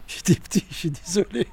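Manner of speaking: words through a breathy laugh. Real, not acted. Father laughing while excusing his childhood self, breathy chuckle. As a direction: chuckle it out, half-apologetic.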